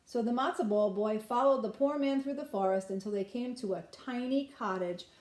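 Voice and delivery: ordinary delivery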